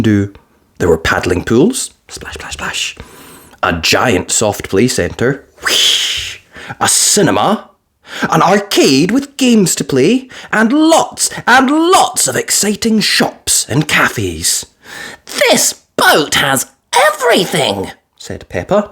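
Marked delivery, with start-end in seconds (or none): 2.1-3.0 soft whisper; whispered sound effect; whispered splash. A narrator's reading. 15.4-17.9 put-on high, piglet-girl voice; bright little girl gasp; young girl's delighted ooh; Peppa voice. A narrator's reading.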